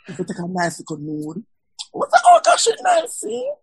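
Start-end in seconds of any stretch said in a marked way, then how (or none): 1.9-3.5 squealing, very high delight; gushing friend. Retold or imitated. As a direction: gush over the picture. Acting friends admiring a nude.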